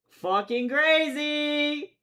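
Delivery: high, loud and drawn out; exaggerated excited shout; shouted repeat. Shown as a demonstration. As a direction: yell it the second time, stretched long.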